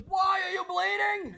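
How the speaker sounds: high, slow goofy buddy voice; mock the scared friend in a high dopey voice; high drawn-out buddy impression; buddy's mocking voice. Buddy voiced, deadpan question.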